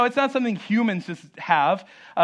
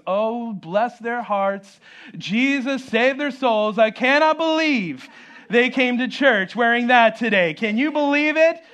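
drawn-out mock pity rising to scandal; hammed-up church gossip, slow then high; judgmental churchgoer; 4 semitones higher, 4 dB louder, slower than usual.

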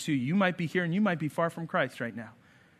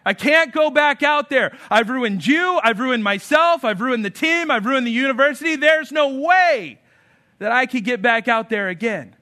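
loud, much higher, despairing cry; distraught player; 8 semitones higher, 8 dB louder than usual. Retold or imitated. Roy at halftime telling his coach he's ruined everything, distraught. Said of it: "And he goes".

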